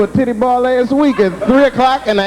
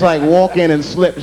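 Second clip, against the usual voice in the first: usual speaking voice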